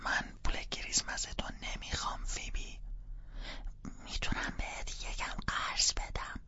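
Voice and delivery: urgent hushed whisper. Acted. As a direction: keep it down, parents are home.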